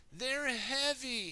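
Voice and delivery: high, slow character voice; storybook Muck. Acted. Storyteller voicing Muck carrying paving stones, storybook character voice. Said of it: "he says".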